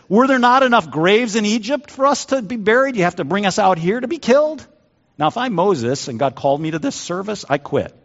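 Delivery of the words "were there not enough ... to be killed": high-pitched panicked complaint; mock yell of a crowd freaking out; acted yelling. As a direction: protest it high, as frantic Israelites. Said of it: "They just start yelling at Moses".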